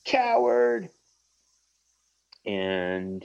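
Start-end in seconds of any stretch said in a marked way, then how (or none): 0.0-0.9 high, drawn-out taunt; pissed off. Acted. Cyclops insulting fleeing villain, acted pissed-off cry. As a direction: hurl the insult high and slow in mock fury. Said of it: "pissed off".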